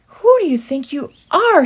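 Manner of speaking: high, loud yell. A narrator's reading. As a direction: shout abuse at him. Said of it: "he yelled".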